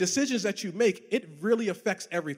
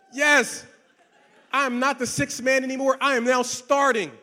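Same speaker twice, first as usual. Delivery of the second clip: higher, louder cheer with a laugh; excited celebration; 6 semitones higher, 6 dB louder than usual. Acted. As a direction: crow about being a starter. Celebrating promotion to starter.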